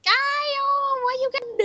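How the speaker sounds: very high, rushed voice; high fast squeak. Pretend. Taunting Kyle in the impression, high fast squeak. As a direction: rattle it off high and quick.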